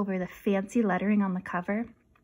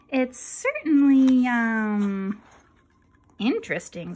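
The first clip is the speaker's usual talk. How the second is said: slow, hesitant tactful remark; polite doubt; 2 semitones higher, 6 dB louder, slower than usual. Acted. Jasper tactfully judging Scruff's pirate hat, slow and hesitant. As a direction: hold back your real opinion.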